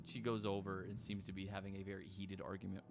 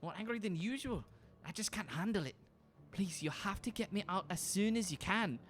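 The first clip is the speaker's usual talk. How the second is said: much higher, frantic, pleading voice; desperate Caroline; 10 semitones higher than usual. Acted. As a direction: rush it out, close to panic, high. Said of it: "She goes".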